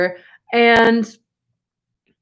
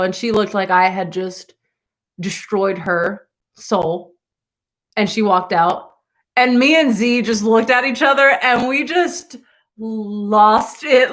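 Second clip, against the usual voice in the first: normal talking voice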